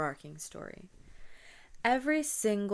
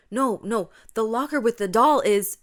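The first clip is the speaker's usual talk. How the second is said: much louder, higher panicked protest; horrified; 5 semitones higher, 13 dB louder than usual. Acted. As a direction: cry out an urgent warning.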